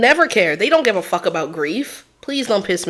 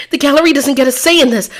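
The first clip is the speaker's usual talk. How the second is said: higher, louder and fast objection; 8 semitones higher, 6 dB louder, faster than usual. Acted. Defense lawyer loudly tells the court the gallery has no say. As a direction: snap it out fast and loud.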